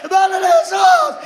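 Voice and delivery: loud, high preacher's cry; fiery preaching. Acted. Preacher rousing the congregation, shouting. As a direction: call out to Jesus with fire.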